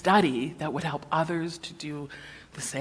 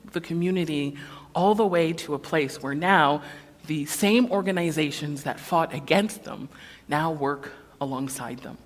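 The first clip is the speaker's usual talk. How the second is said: usual speaking voice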